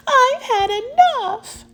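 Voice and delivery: high, drawn-out protest; exasperated cry. Acted. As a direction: wail it slowly, giving up. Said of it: "he cried".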